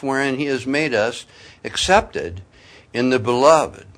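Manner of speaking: loud, formal reading of a Bible passage; scripture reading. Acted. Preacher reading Ephesians aloud to the congregation, formal and loud. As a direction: declaim the verses to the congregation.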